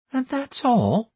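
high, slow, wide-eyed question; incredulous priestess. A narrator's reading. Priestess hears the odd request, high-pitched and incredulous. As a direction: voice the priestess high, taken aback. Priestess's figure, eyes widening.

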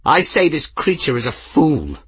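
high-pitched scornful outburst; indignant cry. A narrator's reading. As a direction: cry it out against a rival. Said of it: "Nin cried".

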